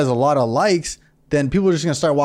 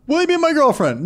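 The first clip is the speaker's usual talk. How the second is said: 1 mock ask; 12 semitones higher than usual. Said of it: "Hold up".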